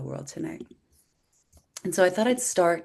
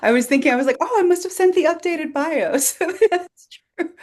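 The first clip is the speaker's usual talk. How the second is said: talking through real laughter, high and loud; amused laughing; 11 semitones higher, 6 dB louder than usual. Real, not acted. Poet laughs with host over an old bio, amused. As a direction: let laughter lift your voice up high.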